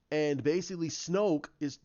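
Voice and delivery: normal talking voice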